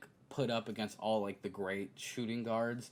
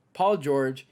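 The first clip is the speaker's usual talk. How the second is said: normal talking voice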